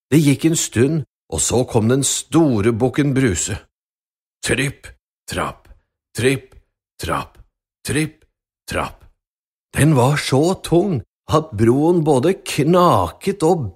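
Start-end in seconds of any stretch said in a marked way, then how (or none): 4.4-9.0 low, heavy tripp trapp; slow ponderous big-goat steps; low heavy footsteps. Acted. Biggest goat crossing the bridge.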